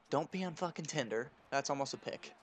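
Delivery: ordinary talk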